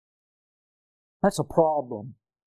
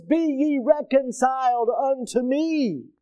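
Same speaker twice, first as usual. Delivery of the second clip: a hint of the Lord's solemn call, slow; slow, raised, solemn invitation; 8 semitones higher, slower than usual. Preacher voicing the Lord's call to sinners, slow and solemn. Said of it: "but the Lord says".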